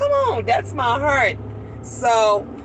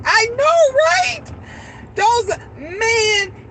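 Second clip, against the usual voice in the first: much higher, gushing agreement; excited; 9 semitones higher, much slower than usual. Real, not acted. Grandmother agreeing with viewers about chunky baby, excitedly high. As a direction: squeal it out delighted.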